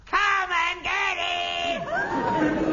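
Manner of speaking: long, high drawn-out shout; resonant bellow. Acted. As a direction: holler it slowly with big ringing resonance. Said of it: "Such resonance".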